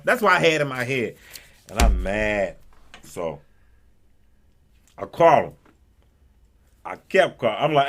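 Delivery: ordinary talk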